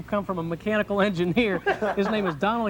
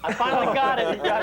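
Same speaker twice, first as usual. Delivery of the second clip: laughing with relief; breaking up with laughter; 4 semitones higher than usual. Real, not acted. Relief after many flubbed takes.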